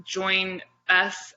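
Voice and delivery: ordinary delivery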